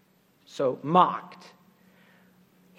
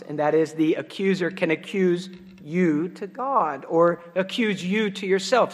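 ordinary talk, nothing marked

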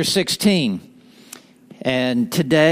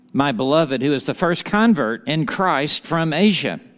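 ordinary delivery

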